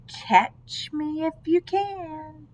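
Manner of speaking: slow, sing-song taunt; playful little voice calling catch me; taunting gingerbread baby. A narrator's reading. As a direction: tease them to give chase. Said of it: "the gingerbread baby shouting".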